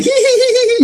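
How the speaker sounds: very high mock giggling; gleeful giggle. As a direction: imitate a coworker tittering with glee. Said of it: "he'd be like".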